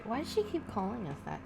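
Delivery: usual speaking voice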